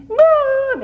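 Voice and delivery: a very high, stretched whine of Mom; whiny call. Retold or imitated. A child whining at her mother, very high and drawn out. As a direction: play the bratty kid calling out. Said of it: "And you whined".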